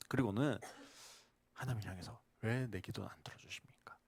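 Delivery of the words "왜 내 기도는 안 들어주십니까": hushed, lower whispered plea to God; whispered complaint. Retold or imitated. Believer secretly grumbles to God in a whisper. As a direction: whisper the question 왜 like a private grumble. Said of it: "그렇게 이야기하겠죠".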